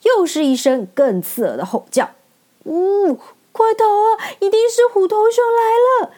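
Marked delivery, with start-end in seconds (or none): 2.6-6.1 much higher, louder panicked wail; terrified cry. Acted. Frightened lion cries out to flee, much higher and louder. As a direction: scream in panic at the beast. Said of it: "狮子惊吓得大叫起来".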